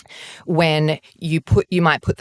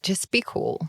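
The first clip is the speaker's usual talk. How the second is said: quiet note to self; self-coaching; 3 semitones higher, 8 dB quieter than usual. Retold or imitated. Warning herself before meeting Claudia.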